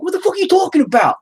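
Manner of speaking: imitating an angry New York wife, very high; hammed-up, fast, high New York outrage; mad New York accent. Husband imitating his mad wife, high New York accent. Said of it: "my wife has that accent when she gets mad at me".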